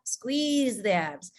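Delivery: slow counted breathing cues; guided breathing. Shown as a demonstration. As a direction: pace their breaths, calm and slow. Leads a breathing exercise.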